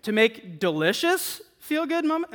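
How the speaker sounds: "delicious" lifted in doubt, then a high-pitched tail; doubtful stress on DELICIOUS. Shown as a demonstration. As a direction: question the word "delicious", voice climbing high after it. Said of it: "depending on where you put the emphasis".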